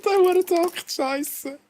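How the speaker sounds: high, cursing in frustration; frustrated outburst. Real, not acted. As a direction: swear at the missed note. Swearing after a missed note. (frustrierter Fluch)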